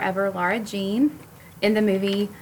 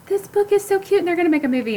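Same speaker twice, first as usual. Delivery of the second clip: high, bubbly excitement; gushing fan; 10 semitones higher, 3 dB louder, faster than usual. Retold or imitated. Bookstagram poster gushing over a book, high and bubbly. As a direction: gush like an online book fan.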